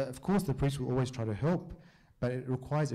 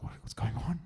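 whisper; 2 semitones higher than usual. Retold or imitated. Baffled aside after cancelling soccer.

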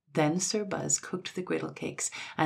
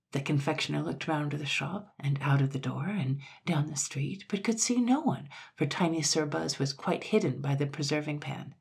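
plain reading voice

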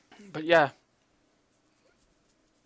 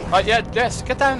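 excited urging; 7 semitones higher, 4 dB louder than usual. Real, not acted. Urging a player during fight.

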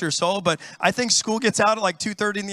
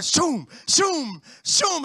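higher mock swoosh of cars speeding by; whooshing car noise; 6 semitones higher, much slower than usual. Pastor imitating free-lane drivers zooming past. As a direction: zip past, shoom shoom. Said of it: "these people are like".

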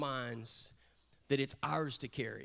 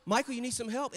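helpful father-in-law; 6 semitones higher, 3 dB louder than usual. Retold or imitated. Voicing cheerful father-in-law offering help.

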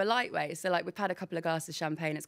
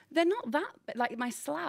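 mock indignant protest; 7 semitones higher than usual. Retells defending her big feet.

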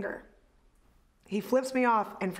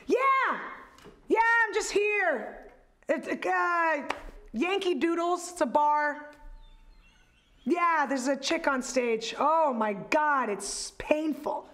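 shouting over a helicopter; 5 semitones higher, slower than usual. Retold or imitated. Heckler on a phone call in the front row, shouting. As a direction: yell into the phone over rotor noise. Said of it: "answers it like he's in a helicopter".

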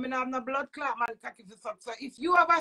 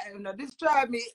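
ordinary talk

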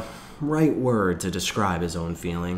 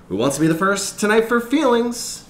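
higher, bright father at dinner; cheerful father voice; 14 semitones higher, 5 dB louder than usual. A narrator's reading. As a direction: ask it like a cheery dad.

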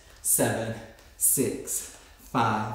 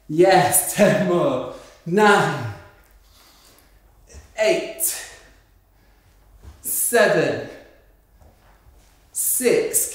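coach cheer; 5 semitones higher, 6 dB louder, much slower than usual. Real, not acted. Coach pushing class on.

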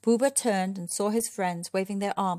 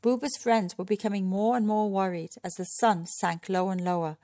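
ordinary delivery

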